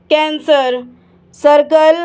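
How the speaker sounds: ordinary talk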